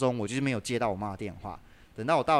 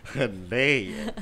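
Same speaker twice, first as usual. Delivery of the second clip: tired laugh; 2 semitones lower, 3 dB louder, much slower than usual. Real, not acted.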